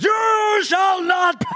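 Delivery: drawn-out high shouted battle cry; full-volume, mock-heroic scream. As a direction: scream it out loud and high, stretching every word. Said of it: "Six stands up and screams".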